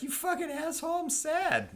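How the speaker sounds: high, whiny mock-child voice; whiny sad retort. Sad kid snapping back at parent, high mock whine. Imagined sad reply to the order.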